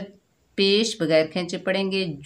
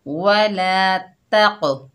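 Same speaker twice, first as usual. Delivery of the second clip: slow, drawn-out Quranic recitation; 5 dB louder, much slower than usual. Shown as a demonstration. Teacher reciting the word for pupils, slow chanted recitation. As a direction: recite it slowly, like tilawat. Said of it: "देखिए इसमें double sound आ रहा है".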